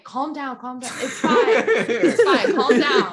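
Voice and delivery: mock soothing through laughter; laughing calm down. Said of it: "he was just like".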